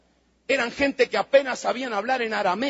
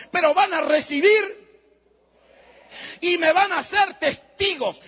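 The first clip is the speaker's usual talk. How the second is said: raised, ringing voice as Jesus; commanding shout; 7 semitones higher than usual. Acted. Jesus voiced commanding his disciples.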